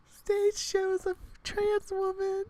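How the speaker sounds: very high, slow, shocked; outraged shock. Real, not acted. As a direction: gasp it out in disbelief.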